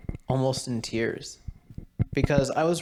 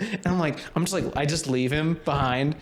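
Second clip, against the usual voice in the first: usual speaking voice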